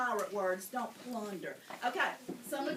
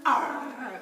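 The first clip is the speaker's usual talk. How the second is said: loud pirate arr; pirate growl; 8 dB louder than usual. Pretend. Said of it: "something else a pirate says".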